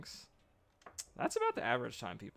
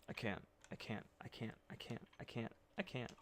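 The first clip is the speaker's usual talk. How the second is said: defeated mumble; 3 semitones lower, 14 dB quieter than usual. Real, not acted. Repeating in despair, very quiet.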